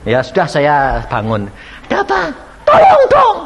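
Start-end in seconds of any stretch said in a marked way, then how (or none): 1.9-2.2 asking at the door. Retold or imitated. Pastor asks at the door. 2.7-3.4 high, loud pleading cry for help; pleading neighbour. Retold or imitated.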